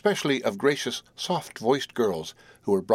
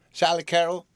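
higher-pitched demanding call; 8 semitones higher than usual. A narrator's reading. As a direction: demand her attention, pitched up. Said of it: "demanded Marilyn".

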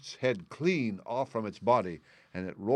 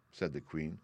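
usual speaking voice

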